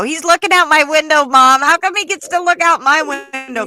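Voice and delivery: put-on high whiny kid complaining; whiny child. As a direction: whine like a bickering kid. Bickering kids voiced in car.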